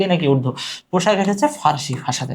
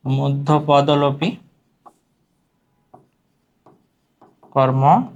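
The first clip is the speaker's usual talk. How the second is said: stretched term, long pause midway; slow dictation; 3 semitones lower, much slower than usual. Shown as a demonstration. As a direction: dictate it piece by piece. Term repeated while writing it. (টেনে টেনে, থেমে থেমে বলা)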